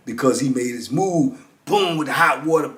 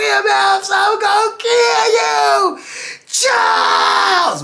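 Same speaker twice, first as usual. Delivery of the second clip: high, loud screamed threats with a crying edge; screaming; 4 dB louder, much slower than usual. Retold or imitated. Inmate screaming through the door at his attacker, high raging scream. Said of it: "he just started screaming".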